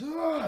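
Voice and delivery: high, loud retold yell; mock yell. Ren engulfed in blue flames, imitated yell. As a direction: let out a high acted yell. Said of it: "He just yells".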